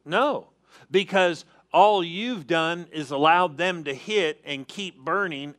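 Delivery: ordinary delivery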